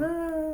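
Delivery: high soft shriek; a quick monster shriek; high scream. Retold or imitated. Mother Butterfly shrieking at the car window, high. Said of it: "is at the window".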